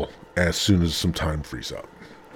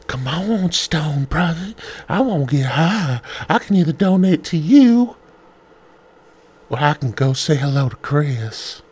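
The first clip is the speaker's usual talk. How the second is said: put-on old Family Guy neighbour; 8 semitones higher than usual. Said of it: "that old dude from Family Guy had a deep voice".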